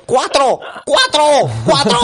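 high, loud barked number; mock shout. Friend retelling a bossy clerk, shouting. Lady barking orders at queue.

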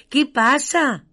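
alarmed, checking on a friend; worried question. Acted. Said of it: "le pregunta monstruo grande".

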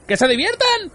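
very high-pitched, outraged question; incredulous yell. A narrator's reading. Arthur throwing Ford's words back at him, incredulous yell. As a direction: shriek it back in disbelief. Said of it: "gritó Arthur".

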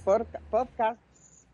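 slow, tripping over "Porca-" before landing on "podcasts"; stumbling attempt. Shown as a demonstration. Trying the English ending and tripping over it, slow. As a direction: fumble "Porca-", then force out "podcasts" slowly. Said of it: "T S es muy pronunciable mal".

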